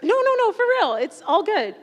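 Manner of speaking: high, loud voice, half laughing, half crying; laughing through tears. Real, not acted. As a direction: laugh it off while holding back tears. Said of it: "I'm just trying not to cry".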